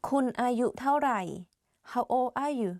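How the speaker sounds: ordinary delivery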